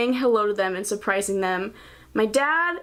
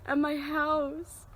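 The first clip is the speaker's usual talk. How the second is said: happy crying; 9 semitones higher, 4 dB quieter, slower than usual. Real, not acted. Tearful reunion with her brother.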